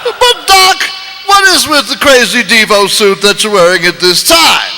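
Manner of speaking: Bill Cosby. Acted. Marty marvelling at Doc's car, Bill Cosby voice. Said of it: "Marty, Bill Cosby".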